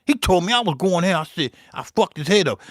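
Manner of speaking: usual speaking voice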